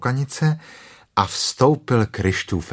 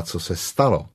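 usual speaking voice

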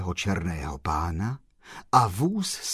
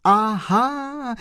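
high, delighted exclamation; sudden gleeful outburst; joyful cry; 13 semitones higher, 3 dB louder than usual. A narrator's reading. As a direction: cry out as it all dawns on you. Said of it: "vykřikl pan Kolbaba radostně".

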